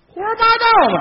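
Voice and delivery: high-pitched indignant outburst; indignant disbelief. Acted. Straight man rejects partner's boast, high indignant disbelief. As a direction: call it out as nonsense, high. Scoffs at partner's exaggerated claim.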